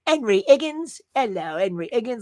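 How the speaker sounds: imitating Cockney with the H's dropped from "Henry Higgins"; a hint of Cockney urchin. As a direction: say "Hello, Henry Higgins" as "'Ello, 'Enry 'Iggins" in light Cockney. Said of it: "all the H's are, are cut off".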